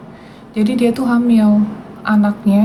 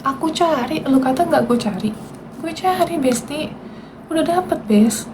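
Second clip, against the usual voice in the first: high, softer gloating lilt; taunting; 5 semitones higher, 5 dB quieter than usual. Real, not acted. Streamer tells the commenter she tracked her down, taunting. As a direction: lightly rub it in, pitched up.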